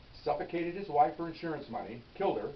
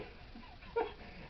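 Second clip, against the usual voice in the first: short airy laugh bursting out; high breathy laugh of disbelief; real burst of laughter; 10 dB quieter than usual. Real, not acted. Laughing at the murder twist.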